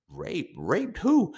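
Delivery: incredulous, cracking high on the question; shocked disbelief. Retold or imitated. Shocked reply to police accusation.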